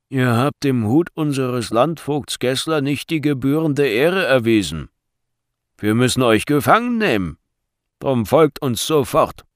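forceful, officious order; stern guard. A narrator's reading. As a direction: announce an arrest with full authority. Guard announces an arrest.

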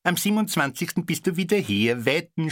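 high, teasing jab; spiteful little wager; needling taunt. A narrator's reading. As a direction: needle her with a bet. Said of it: "stichelten sie".

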